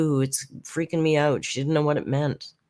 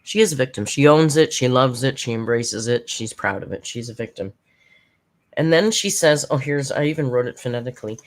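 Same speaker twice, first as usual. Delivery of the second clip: usual speaking voice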